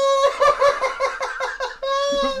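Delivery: real squealing laugh at a friend; high shrieking laugh. Real, not acted. Laughing at cohost's outburst.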